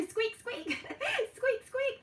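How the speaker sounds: high playful "Squeak, squeak"; mouse squeak. Shown as a demonstration. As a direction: squeak like a little mouse for toddlers. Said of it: "What noise does a mouse make?".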